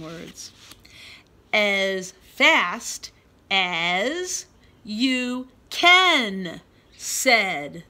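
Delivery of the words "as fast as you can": slow then loud. Shown as a demonstration. Reading story line expressively.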